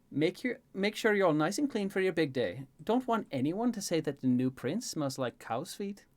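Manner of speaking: fussing Elaine. Acted. Elaine readying the new prince, teasing fuss. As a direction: fuss over him playfully.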